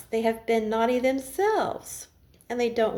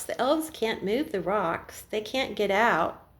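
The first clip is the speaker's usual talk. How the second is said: ordinary delivery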